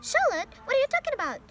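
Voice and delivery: high, loud, stunned disbelief; shocked cry. A narrator's reading. Pig hearing his friend will die, crying out in shock. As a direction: cry out in alarm, pitch leaping up. Wilbur shocked by Charlotte's news.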